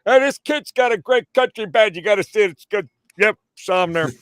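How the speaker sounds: very high, loud imitated booster; Don. Sports personality raving about the kid's country band, high and loud. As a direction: crow it out in a high, punchy impression. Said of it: "Don and Bobby saw me".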